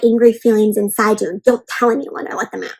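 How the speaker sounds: ordinary talk, nothing marked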